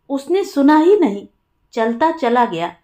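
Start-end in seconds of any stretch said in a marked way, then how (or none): none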